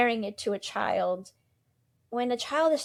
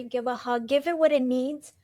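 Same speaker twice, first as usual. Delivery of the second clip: usual speaking voice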